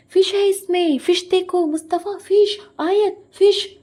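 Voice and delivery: high, loud mock shouting; hammed-up yelling to point out a sight; overacting. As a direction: imitate an over-excited shouter. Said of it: "इसकी इतनी over acting".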